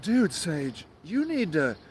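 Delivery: soft, condescending mock murmur; mock moderate advice. Moderates telling Sage to calm down, soft patronizing murmur. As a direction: talk down to him gently, like a patronizing buddy. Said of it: "they're like".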